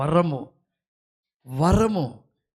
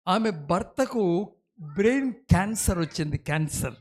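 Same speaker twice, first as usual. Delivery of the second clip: normal talking voice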